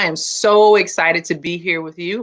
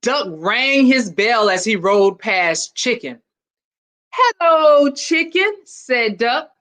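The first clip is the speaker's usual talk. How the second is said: put-on lively read-aloud for children; storybook reading; 5 semitones higher, slower than usual. A narrator's reading. Principal reading a picture book to students, lively storytelling. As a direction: tell it like a picture book to a class.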